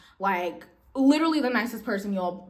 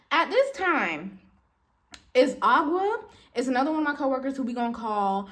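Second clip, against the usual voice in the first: ordinary talk, nothing marked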